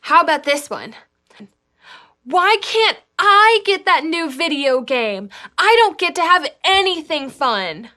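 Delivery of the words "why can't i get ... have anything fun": put-on high, loud whining kid; whiny voice. Shown as a demonstration. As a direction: complain like a spoiled child. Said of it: "we have to do them in our whiniest voices".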